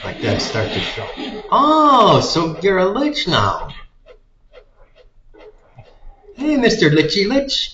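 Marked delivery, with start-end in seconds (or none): 1.5-7.7 high, loud mocking taunt. Real, not acted. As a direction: tease the monster loudly. Mocking the game enemy.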